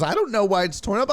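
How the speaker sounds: wife insisting she doesn't know, defensive; mock wife protest. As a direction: mimic her insisting she's innocent. Acted quarrel with Nora.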